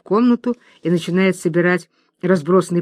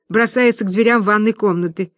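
ordinary talk

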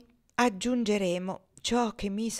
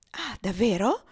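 lightly voiced naive surprise; a touch of wide-eyed astonishment; innocent amazement; 2 semitones higher, 4 dB louder than usual. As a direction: ask with guileless wonder. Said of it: "con aria di innocente stupore".

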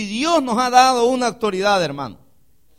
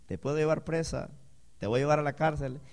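smiling calm threat; 4 semitones lower, 8 dB quieter than usual. Retold or imitated. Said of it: "Se lo decía sonriendo".

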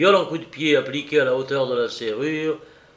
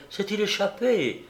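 urgent cry; 7 semitones higher than usual. A narrator's reading. Rescued woman asks about an escape, crying out. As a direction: press the question in a raised pitch. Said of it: "s'écria-t-elle".

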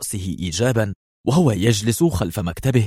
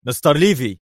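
his name snapped high in anger; mild angry address; 6 semitones higher, 4 dB louder than usual. Acted. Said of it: "قال برونو في غضب".

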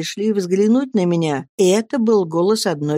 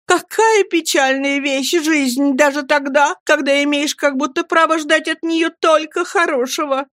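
petulant lament; 8 semitones higher than usual. A narrator's reading. Mother laments her lot, high and self-pitying. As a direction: sigh it out as a martyr. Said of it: "Капризно-раздражительным тоном".

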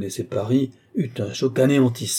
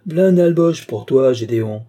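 raised voice, easing off on the name; calling out; 3 semitones higher, 7 dB louder than usual. A narrator's reading. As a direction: call over to a colleague a few steps away. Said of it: "lui cria".

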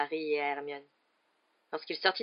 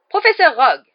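high, loud shocked rebuke; indignant; 8 semitones higher, 18 dB louder than usual. A narrator's reading. Matron rebuking the raging professor, indignant. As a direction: cry out in outrage. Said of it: "s'indigna madame Pomfrey".

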